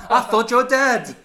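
high, loud, drawn-out cry of disbelief; mock shocked shout. Friend acting out the Kilgrave twist, mock shocked shout. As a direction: yell it like a hammed-up plot twist.

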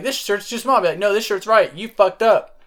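bossy shout. Retold or imitated.

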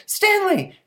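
very high, loud startled yell; mom's shriek. Acted. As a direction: shriek the boy's name in shock. Mom shrieks at son.

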